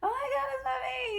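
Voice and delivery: mock-tearful high squeal; teary mommy squeal. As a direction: squeal like a mom about to cry. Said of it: "I was like".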